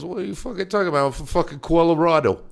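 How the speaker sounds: high, fast mock Eastern accent; hammed-up East Coast indignation; imitated Eastern accent. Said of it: "I fucking love that Eastern accent".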